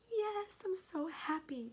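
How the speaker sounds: high, slow, gushing yes; light up with joy; delighted yes. Retold or imitated. Answering the midwife with a delighted, gushing yes. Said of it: "And I'm like".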